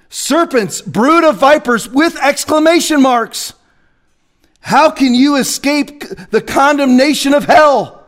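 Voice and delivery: high, forceful scripture quote; fiery preaching. Acted. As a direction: thunder the woes of Jesus.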